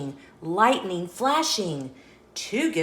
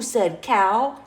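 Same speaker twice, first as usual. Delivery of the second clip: ordinary delivery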